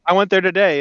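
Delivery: ordinary delivery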